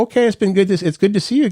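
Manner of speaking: high, bright hint to leave; hinting host. Retold or imitated. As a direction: wrap it up politely, hinting it's time to go. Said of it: "giving them hints to get out".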